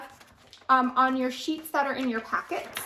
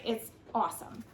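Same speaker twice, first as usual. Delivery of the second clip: usual speaking voice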